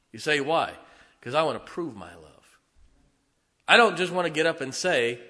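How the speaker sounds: loud, emphatic pulpit delivery; rally the church with conviction; fervent preaching. Acted. Preaching at full force.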